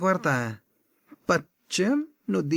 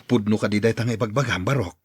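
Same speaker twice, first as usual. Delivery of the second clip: low, deeper put-on man's voice; older man voice; 9 semitones lower than usual. Tata Ben answering the boy in a low voice. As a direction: drop low like an older uncle.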